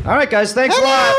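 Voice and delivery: high put-on Australian voice, thanking the crowd; cheery hammed-up Aussie send-off; mock Australian. Comic host doing a mock Australian farewell.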